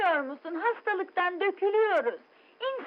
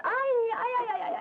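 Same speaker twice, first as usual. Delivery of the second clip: wailing lament; 8 semitones higher than usual. Acted. Dramatic lament.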